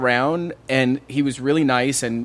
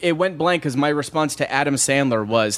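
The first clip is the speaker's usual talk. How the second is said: ordinary talk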